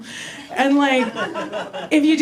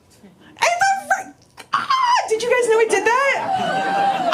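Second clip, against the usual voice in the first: delighted surprise; slower than usual. Real, not acted. Surprised by rainbow light effect.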